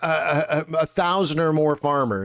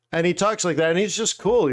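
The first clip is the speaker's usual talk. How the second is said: normal talking voice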